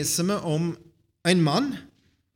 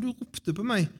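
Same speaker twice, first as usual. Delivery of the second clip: put-on high-pitched boy's reply; eager boy answering; 7 semitones higher than usual. A narrator's reading. Samuel explains himself to Eli, eager reply. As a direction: lift the pitch as young Samuel.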